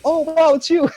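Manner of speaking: high, put-on surprised greeting; fake recognition. To an acquaintance, mock delighted recognition. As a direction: pretend you know them, gushing. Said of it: "I was like".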